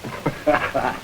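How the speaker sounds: a quiet scoffing laugh; scornful laugh. Acted. Suspect to the detective, a soft scornful laugh. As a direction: laugh off the evidence.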